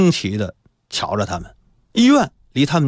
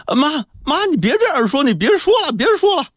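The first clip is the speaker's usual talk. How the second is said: put-on very high, urgent child begging; acted crying plea; 4 dB louder than usual. A narrator's reading. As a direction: plead as if in tears, voice way up. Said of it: "他一边哭".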